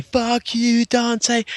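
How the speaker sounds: high-pitched taunt at normal volume; mock defiant taunt. Re-enacts his taunt on film.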